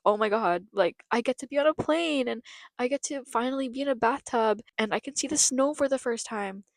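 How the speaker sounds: put-on excited child. Girl told she is moving to Canada, excited. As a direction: gush like a kid listing new adventures.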